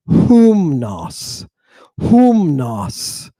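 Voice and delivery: "humnos" slowly, opened with an audible H; rough breathing H. Shown as a demonstration. Teacher demonstrating rough breathing, initial H. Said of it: "you add an H".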